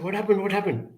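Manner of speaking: high, urgent concerned father asking twice; a father rushing in, asking anxiously; worried father. Retold or imitated. Father runs out to his crying child, anxious and high.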